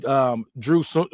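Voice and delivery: plain reading voice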